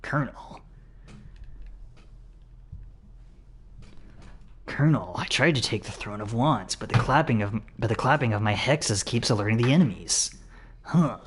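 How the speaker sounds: quiet, hushed soldier voice; lightly voiced stealth soldier; Solid Snake impression. Shown as a demonstration. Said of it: "you kind of inflect your voice like this".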